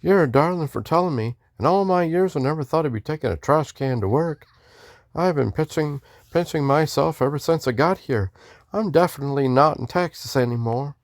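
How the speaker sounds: high-voiced Texan greeting in a Western accent. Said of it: "I was doing a Western accent".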